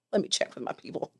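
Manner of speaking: hushed, coy stalling line; mock self-important. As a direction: play coy, as if you're important.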